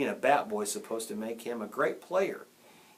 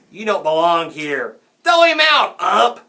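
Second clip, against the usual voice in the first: loud shout climbing higher; harsh, mean heckling; 6 semitones higher, 14 dB louder than usual. A narrator's reading. Grown-up hecklers yell at the umpire, harshly. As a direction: yell it to drive someone off. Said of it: "Their voices had grown harsh and mean".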